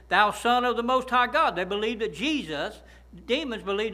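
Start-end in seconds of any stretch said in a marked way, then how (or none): 0.1-1.5 loud, higher declaring of the demon's words; demon's proclamation. Retold or imitated. Preacher quoting the demon crying out to Jesus, loud. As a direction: boom it out like the possessed man crying to Jesus. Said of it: "He said".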